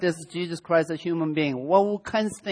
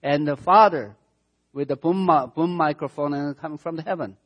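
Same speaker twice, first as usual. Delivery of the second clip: ordinary talk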